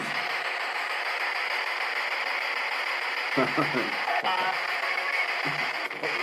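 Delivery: long helpless laughing fit. Real, not acted. Ghost hunter cracking up at a teammate, laughing. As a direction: laugh on and on, unable to stop. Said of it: "I'm sorry, I'm laughing at Andy".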